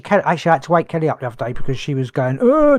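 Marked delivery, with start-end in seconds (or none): 2.4-2.8 high sudden cry out; sleep shout. Retold or imitated. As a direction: let out a startled sleep shout. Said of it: "doing the same thing".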